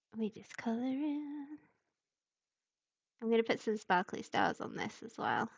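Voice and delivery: ordinary delivery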